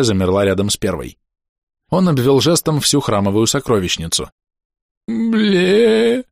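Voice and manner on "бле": дрожащий вскрик. A narrator's reading. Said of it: "изрёк затрясшийся сухонький хранитель".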